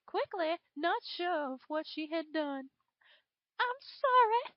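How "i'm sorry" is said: high, broken, near tears; flustered tearful apology. A narrator's reading. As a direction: stumble out a shaky sorry. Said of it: "she stumbled to find the right words".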